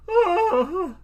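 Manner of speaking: high, loud dying scream; scream of pain. Pretend. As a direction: cry out as a background extra getting killed. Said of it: "a scream of pain as he dies".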